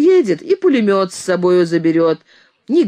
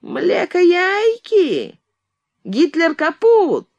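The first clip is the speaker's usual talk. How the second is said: much higher, slow, insulting squeaky voice; mock him in a shrill thin voice; nasty thin voice; 13 semitones higher, much slower than usual. A narrator's reading. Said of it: "противным, оскорбительно тоненьким голосом". (противным тоненьким голосом)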